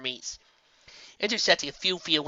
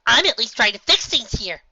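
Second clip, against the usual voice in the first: put-on high young filly voice, louder; a small filly insisting to a dark queen; 1 Twilight pleading; 9 semitones higher, 8 dB louder than usual. A narrator's reading. Filly tells Nightmare Moon she is fixing things, high pleading voice.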